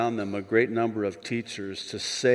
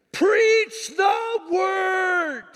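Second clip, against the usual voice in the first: very high, drawn-out preacher's shout; announced big shout; 6 dB louder, much slower than usual. Shown as a demonstration. Pastor delivering Paul's charge to pastors, huge drawn-out shout. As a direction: bellow each word slowly at full force. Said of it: "Wait for it. Here it comes".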